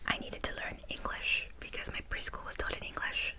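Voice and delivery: soft, unvoiced ASMR whisper; whispered aside. Drops into a whisper.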